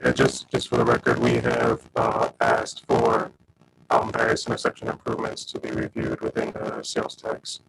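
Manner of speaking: deep, distorted scrambled voice; garbled audio. Real, not acted. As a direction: sound like a broken mic, garbled and low. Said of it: "your audio is all garbled".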